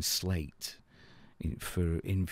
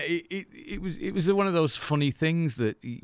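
ordinary talk, nothing marked

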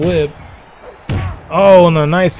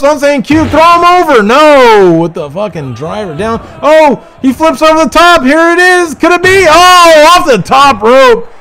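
high, loud ringside calling; wrestling commentator at full pitch; excited play-by-play; 9 semitones higher, 5 dB louder than usual. Acted. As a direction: hype every move as it happens. Play-by-play of ring action.

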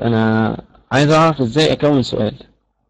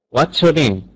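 usual speaking voice

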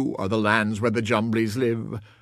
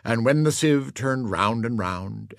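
usual speaking voice

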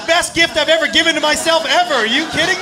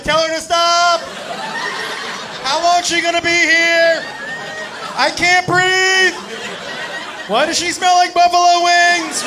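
smothered groom pleading; 5 semitones higher than usual. Acted. Groom pinned under her calls to friends, strained cry. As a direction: call out desperately from under a weight. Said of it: "He would be like".

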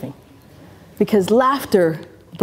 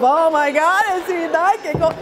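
mock dramatic; 8 semitones higher than usual. Mock dramatic ending quote.

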